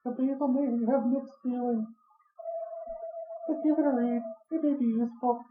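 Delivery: put-on high, thin old lady voice; old woman voice. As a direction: talk like a kindly old librarian. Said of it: "giving her the old woman voice".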